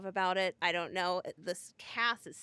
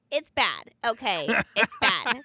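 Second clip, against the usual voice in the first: louder, emphatic it's bad; bad stressed louder each time; blunt admission; 10 dB louder, slower than usual. Real, not acted. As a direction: own it, slowing on the second bad. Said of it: "I'm not gonna be the f".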